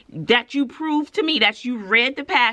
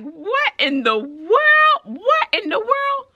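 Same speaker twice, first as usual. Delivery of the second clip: high, drawn-out astonishment; stunned disbelief; 11 semitones higher, slower than usual. Retold or imitated. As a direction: stretch it out high, utterly baffled. Teacher stunned by screaming student.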